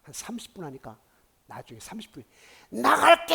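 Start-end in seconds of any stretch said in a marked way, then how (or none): 2.7-3.3 very loud, high drawn-out scream; possessed person screaming in surrender; demon's yell. Retold or imitated. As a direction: cry out like a demon being driven out. Acting the demon giving in.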